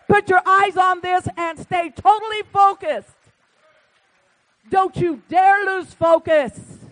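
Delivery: loud, high, pounding sermon voice; full-force pulpit preaching, stressing each word; fiery preaching. Acted. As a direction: preach it hard to the congregation.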